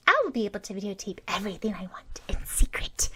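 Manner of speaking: fast, hushed scheming; a girl whispering her plan to film in secret; conspiratorial whisper. A narrator's reading. Girl boasts secret videotaping.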